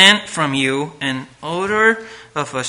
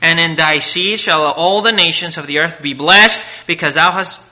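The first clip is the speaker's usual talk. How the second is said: loud, fervent preaching; preaching at full force; 4 dB louder than usual. Acted. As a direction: proclaim it from the pulpit. Preaching God's promise.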